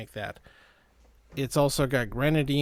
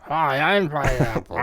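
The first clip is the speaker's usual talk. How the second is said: cartoony pineapple voice; 5 dB louder than usual. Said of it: "cartoony voice".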